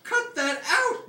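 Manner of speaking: high, slow, loud yell; yelling at a dinosaur. Acted. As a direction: shout it out. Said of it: "Regis yelled".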